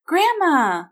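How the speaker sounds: put-on high, loud child's happy cry; delighted greeting. A narrator's reading. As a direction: greet a grandmother with glee. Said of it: "Will smiled from ear to ear".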